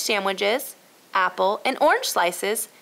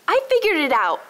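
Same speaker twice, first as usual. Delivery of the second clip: loud cry of discovery, high; excited shout; 10 semitones higher, 5 dB louder than usual. A narrator's reading. Emma leaps up shouting the solution. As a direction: shout out the answer together. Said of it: "shouted Emma".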